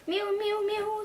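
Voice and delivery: high meowing cat voice, sung proudly; cat mewing. Acted. Cat serenading Martina, proud high mews. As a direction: mew it out like a proud singing cat. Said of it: "sang the cat proudly".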